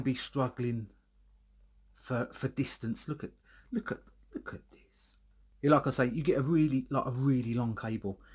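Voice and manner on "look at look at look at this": whisper. Real, not acted. Showing off the long cable.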